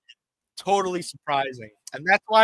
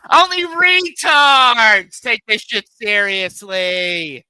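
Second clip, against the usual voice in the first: loud, high hammed-up impression, words stretched out; Kimmy; 7 semitones higher, 10 dB louder, slower than usual. Streamer channels an inner character to mock critics, loud high impression. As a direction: yell it high like a taunting caricature. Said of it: "channel my inner Kimmy".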